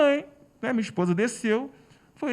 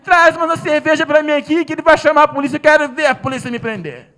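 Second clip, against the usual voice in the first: loud, higher, taunting order; defiant taunt; 7 semitones higher, 10 dB louder than usual. Retold or imitated. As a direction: dare them to arrest you. Woman taunts, ordering beer.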